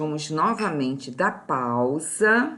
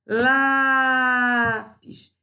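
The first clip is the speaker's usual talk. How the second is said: "Lápis" with a loud, high, held "Lá"; stressed syllable drawn out; 5 semitones higher, 5 dB louder than usual. Shown as a demonstration. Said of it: "Sílaba tônica penúltima".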